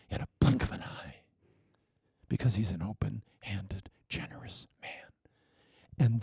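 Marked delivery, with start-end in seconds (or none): 0.1-4.9 hushed, much softer whisper. Acted. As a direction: sink to a whisper. Hushed turn in sermon.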